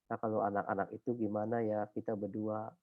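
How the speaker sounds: hushed musing. Retold or imitated. Wife's quiet question.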